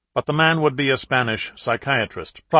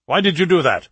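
higher, louder demanding voice; a raised, cold demand; demanding terrorist; 7 semitones higher, 6 dB louder than usual. A narrator's reading. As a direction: challenge him sharply down the line. Terrorist leader demands on speakerphone.

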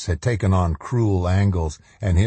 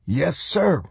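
put-on higher, brisk young soldier voice; eager lieutenant; 9 semitones higher than usual. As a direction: report dutifully to the colonel.

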